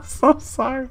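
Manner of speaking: high, helpless laughing; squeaky with laughter, words stretched out; real laughter. Real, not acted. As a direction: talk through a laughing fit. Cracks up at own joke.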